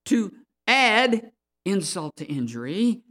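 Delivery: loud, slow preaching, each phrase hammered out; forceful preaching. Real, not acted. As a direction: preach it hard, stretching the key words.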